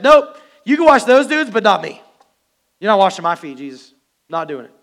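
defiant disciple shouting no; stubborn Peter. Acted. Peter refusing Jesus, acted.

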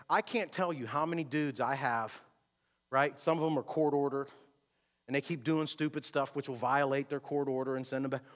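ordinary delivery

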